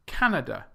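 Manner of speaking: Canada as CAN-uh-duh; CAN loud and strongest in Canada; first-syllable stress. Shown as a demonstration. Teacher modelling the right word, CAN stressed in Canada. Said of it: "stress is on the first syllable".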